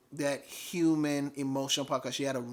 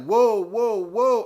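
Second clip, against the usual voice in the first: higher, louder retold "hold on" cry; alarmed protest; 7 semitones higher, 8 dB louder, much slower than usual. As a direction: call out to stop someone, voice raised. Startled patient protests rough handling.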